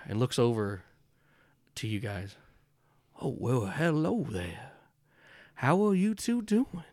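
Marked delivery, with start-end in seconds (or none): 3.2-6.8 high, slow, overly polite gentleman; a sweet-talking charmer greeting strangers; genteel stranger voice. Acted.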